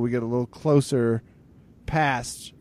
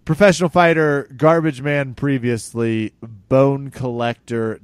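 mock fighter introduction, louder then slowing; hype intro; 2 semitones higher, 6 dB louder, slower than usual. Host hyping the guest's fighter credentials. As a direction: announce him like a ring announcer. Announcer-style intro of GSP.

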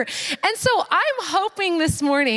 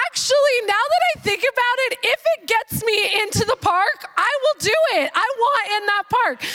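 mock giddy determination; giddy resolve; 8 semitones higher than usual. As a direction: talk yourself into it gleefully.

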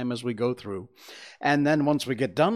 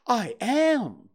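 much higher and louder bright little bear; cheerful Pooh; 10 semitones higher, 4 dB louder than usual. A narrator's reading. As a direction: chirp it out like a friendly bear. Pooh replies.

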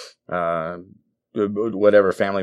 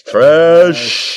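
loud, high dismissive jeer; scornful loud jeer; 8 semitones higher, 8 dB louder than usual. Acted. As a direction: shout the word trash like booing. Trash-talking the Cowboys.

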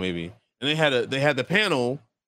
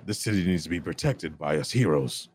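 much lower, heroic superhero voice; 9 semitones lower, 4 dB quieter than usual. Shown as a demonstration. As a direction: go deep and heroic, like a caped defender. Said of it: "if I was directed to do, uh, a superhero".

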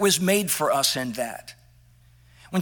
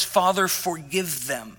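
quiet prayer; 3 semitones lower, 6 dB quieter than usual. Retold or imitated. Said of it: "managed to say the words".

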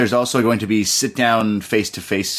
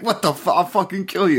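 hammed-up threat, higher; mock rage; 8 semitones higher than usual. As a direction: fake fury while being held back. Bobby Fish raging, held back.

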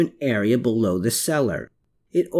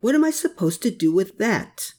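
higher-pitched, baffled question; confused; 8 semitones higher than usual. A narrator's reading. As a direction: ask it at a loss, pitch raised. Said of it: "at the dial in confusion".

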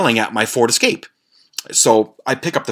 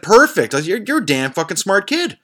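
proud praise; 9 semitones higher than usual. Retold or imitated. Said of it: "And I said".

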